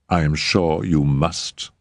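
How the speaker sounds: plain reading voice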